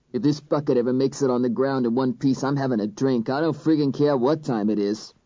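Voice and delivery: lightly voiced rough-edged cop; a hint of a tough cop; gruff cop voice. A narrator's reading. Marino's voice in narration.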